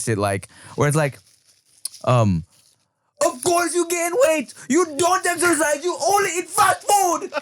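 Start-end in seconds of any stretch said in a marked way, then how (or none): 3.2-7.3 high-pitched mock Indian-accented rant; Indian accent yelling. Imitating an online Indian man scolding followers, Indian-accent yelling. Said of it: "Indian people yelling is the best".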